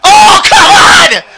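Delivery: long drawn-out yell of disbelief; frustrated shout. Real, not acted. Gamer yelling at the goalie, anguished shout. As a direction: howl it at the screen after the miss. Missed scoring chance while gaming.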